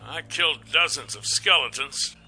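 light, cocky little beast; Fang's voice. Acted. Fang boasting to Nath, in a high soft cocky voice.

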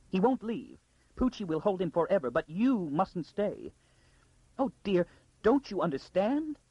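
high, urgent insistence; frantic pleading. A narrator's reading. Lady begs the girl not to stay, frantic pleading.

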